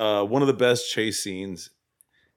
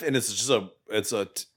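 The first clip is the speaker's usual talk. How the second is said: usual speaking voice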